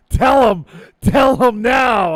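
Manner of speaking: loud, slow insistent demand; mock shout. Host retelling co-host's urgent demand as a shout. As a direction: bark the order in mock urgency. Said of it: "I'm like".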